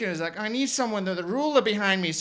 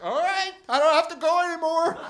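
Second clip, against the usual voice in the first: put-on gleeful teen; 9 semitones higher, 6 dB louder than usual. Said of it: "And I was like".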